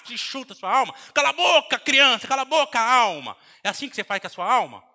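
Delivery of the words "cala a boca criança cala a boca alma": repeated yelled rebuke, much louder; angry shout. Retold or imitated. Preacher shouting down child and soul, angry. Kicking at your own soul.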